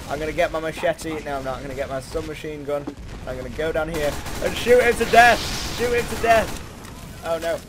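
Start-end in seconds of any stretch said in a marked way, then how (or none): none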